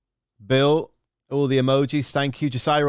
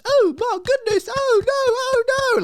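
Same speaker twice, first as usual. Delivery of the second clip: very high, loud falsetto shriek; Mrs Doubtfire panic; 4 dB louder than usual. Retold or imitated. Pilot spotting the snake, very high panicky falsetto. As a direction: squeal like Mrs Doubtfire burning the pans. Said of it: "like Missus Doubtfire with the pans".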